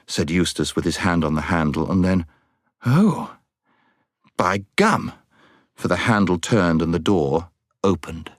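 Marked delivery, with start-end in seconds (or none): 2.8-5.1 surprised. Acted. Door opens unexpectedly.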